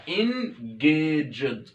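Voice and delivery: "engaged" drawn out slowly, the g sounded like j; teacher modelling how to say "engaged" for learners; slow pronunciation. Shown as a demonstration. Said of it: "دي تتنطق جيم".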